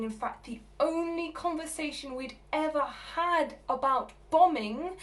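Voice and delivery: normal talking voice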